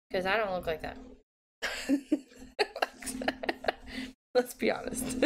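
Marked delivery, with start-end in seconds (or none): 1.6-4.1 long, high giggle; laugh. Real, not acted. Crafter saying she doesn't look like that, giggling. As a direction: burst into self-amused giggling. Amused at her own candour.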